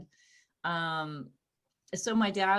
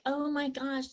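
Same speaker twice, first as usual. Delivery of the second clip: put-on girlish invite; 7 semitones higher than usual. A straight friend invites her over, lightly high and sweet. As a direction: coo the sleepover offer. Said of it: "you get girls going like".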